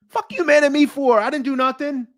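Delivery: high, loud, exasperated; indignant yelling. Real, not acted. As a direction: throw it back at him. Yelling at commenter Angelo.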